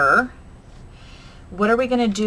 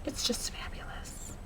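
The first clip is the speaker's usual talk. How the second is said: breathy whisper, It's just fabulous; whispered delight; 4 semitones higher, 16 dB quieter than usual. Real, not acted. Crafter whispering to herself about the paper, hushed.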